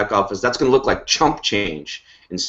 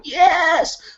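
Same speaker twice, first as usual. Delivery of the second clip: a much higher, thrilled shout of "Yes!"; imitated excited yes. Host imitating a thrilled teammate, high shouted cheer. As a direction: squeal it like a fan cheering. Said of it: "Steph is watching this going".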